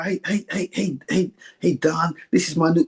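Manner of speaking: imitating a boxing manager's American accent, not very well; bad American accent. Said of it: "pardon my, my American accent, it's not very good".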